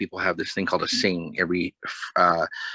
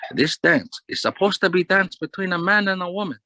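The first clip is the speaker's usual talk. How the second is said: imitating a Kahnawake Mohawk accent, much higher pitched; 12 semitones higher than usual. Lady telling off two men dancing together, in a Kahnawake Mohawk accent. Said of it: "that Kahnawake accent, Mohawk accent".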